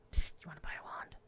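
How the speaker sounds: fast whispered shady dealer pitch; secretive raspy sales murmur. Pretend. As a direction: hiss it like a back-alley salesman. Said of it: "This shady dealer".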